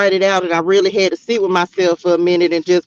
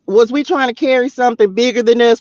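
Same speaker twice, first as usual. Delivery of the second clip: usual speaking voice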